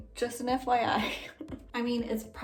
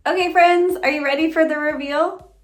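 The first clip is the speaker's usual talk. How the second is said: gushing makeover reveal; 4 semitones higher, 7 dB louder than usual. Real, not acted.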